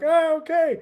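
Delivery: high, loud mock timid reply; meek soldiers. Soldiers answering their general, high mock meek voice. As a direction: squeak out a startled okay. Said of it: "They're all like".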